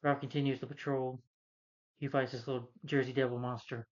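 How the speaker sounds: ordinary talk, nothing marked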